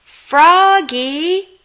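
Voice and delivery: high, loud call from far off. Acted. The teacher calls across the patch, loud. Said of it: "called Miss Witherspoon".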